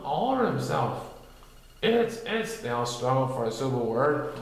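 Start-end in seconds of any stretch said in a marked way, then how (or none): 1.8-2.6 high, halting character voice; high fumbling voice. A narrator's reading. Friends stuck for a word, high and halting. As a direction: stammer for the right word.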